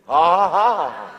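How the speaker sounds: loud mocking spoken ha ha; taunting laugh. Shown as a demonstration. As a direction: laugh them off scornfully. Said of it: "what I say to C N N".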